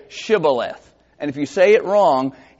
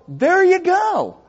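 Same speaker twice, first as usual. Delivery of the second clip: ordinary talk, nothing marked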